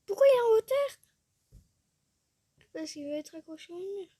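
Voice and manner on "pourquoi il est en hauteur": high, loud astonished question; baffled outcry. Real, not acted. Player baffled by a raised shower, high and loud. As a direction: shriek out the puzzled question.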